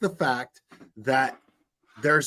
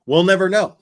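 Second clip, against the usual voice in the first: a loud jab, we'll never know; loud sarcastic exclaim; 3 semitones higher, 9 dB louder than usual. Real, not acted. Commentator scoffs loudly at the missing evidence. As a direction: punch it out loud and mocking.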